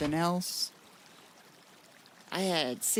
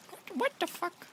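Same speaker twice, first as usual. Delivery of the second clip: very high, small baffled voice; high-pitched bafflement; 4 dB quieter than usual. Real, not acted. Puzzled by soldering in there, high-pitched bafflement. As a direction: repeat it way up high in disbelief.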